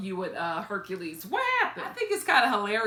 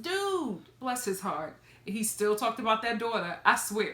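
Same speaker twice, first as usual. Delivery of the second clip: ordinary delivery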